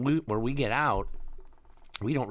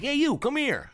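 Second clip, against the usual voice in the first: high calling cop; 5 dB louder than usual. Retold or imitated. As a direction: shout it like a cop.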